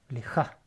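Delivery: "leja" ending in a guttural j and an open a; j sound plus a. Shown as a demonstration. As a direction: demonstrate "leja" with a throaty j before the a. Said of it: "con sonido de j y una a".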